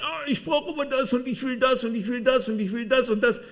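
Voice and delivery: high, loud, demanding tantrum; screaming soul. Acted. Preacher acts out the clamouring soul, screaming high and loud. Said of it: "und sie schreit".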